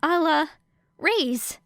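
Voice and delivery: put-on hesitant girlish raise; faux-naive girl. A narrator's reading. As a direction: pretend to dither before betting. Era pretending hesitant bet.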